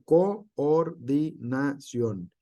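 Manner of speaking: Coordinación said very slowly, each syllable apart, the oo drawn out as two vowels; slow syllable split. Shown as a demonstration. Teacher showing students the hiatus, slow split syllables. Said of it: "es un ejemplo".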